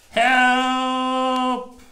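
high, loud cry for help; loud squeak. Acted. Reading to a child, a tiny meerkat squeaks loudly for help. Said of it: "squeaked very loudly".

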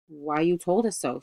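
ordinary delivery

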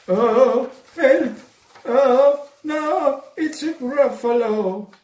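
high, loud cry for help; panicked cry. Acted. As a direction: shriek it out in sudden terror.

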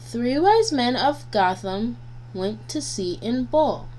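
plain reading voice

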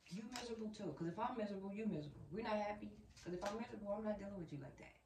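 ordinary talk

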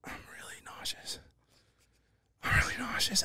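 weak, whispered complaint; sick whisper. Retold or imitated. George whispers to his seatmate that he feels sick. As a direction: whisper it like you might throw up. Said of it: "he goes".

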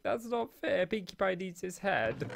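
imitating the daughter upset over her broken pony; daughter's voice. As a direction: pitch it up like a little girl upset over her toy. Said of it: "my daughter's like".

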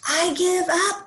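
high-pitched exasperated outburst; high, frustrated cry at moderate volume; mock furious yell. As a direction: give up in a storybook huff. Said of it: "Izzy yelled with a furious frown".

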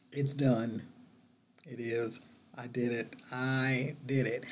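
soft, awed murmur; hushed disbelief. Real, not acted. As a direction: let it sink in quietly. Revealing her finished big chop.

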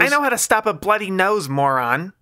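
high, loud snapping woman. A narrator's reading. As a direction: snap it at him. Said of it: "she snapped".

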